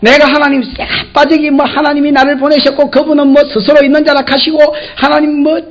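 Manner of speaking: normal talking voice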